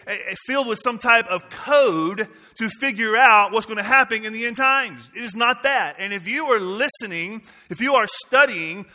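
loud, driving pulpit preaching. Acted. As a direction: preach it out boldly to the church. Pastor preaching to congregation.

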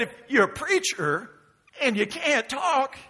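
retold sarcastic reasoning. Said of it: "what sounded sarcastic".

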